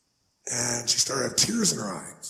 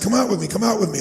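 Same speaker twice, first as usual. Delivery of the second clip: calling out; 7 semitones higher, 4 dB louder, faster than usual. Retold or imitated. Calling boys to help.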